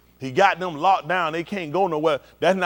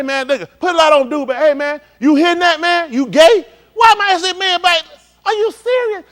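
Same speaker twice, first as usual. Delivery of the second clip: loud, high, rising to a shout; mock comedian roasting; 10 semitones higher, 10 dB louder than usual. Comedian calling out a man in the crowd, loud mocking shout. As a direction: roast the guy in the crowd, getting louder.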